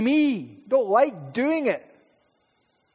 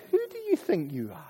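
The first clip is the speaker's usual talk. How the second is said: sneering put-down; 6 dB quieter, faster than usual. Retold or imitated. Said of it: "a great expression is".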